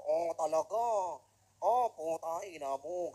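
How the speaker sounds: loud, clipped answer in a mock Japanese manner; mock Japanese reply. Seaman answers the Japanese captain in loud mock Japanese style. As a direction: fire it back like the captain's own barking. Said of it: "sinasagot ko".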